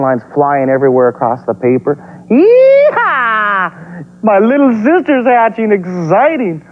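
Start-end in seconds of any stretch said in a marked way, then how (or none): 2.3-3.7 cowboy whoop. Acted. Excited dinosaur cheer. 4.2-6.6 much higher cartoon kid voice, slow in places; excited little dinosaur. Eek voice again.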